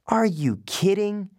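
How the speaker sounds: slow, high disbelief; can't believe he asked; incredulous. A narrator's reading. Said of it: "looked at me as if".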